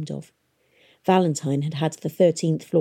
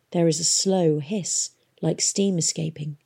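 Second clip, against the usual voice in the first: usual speaking voice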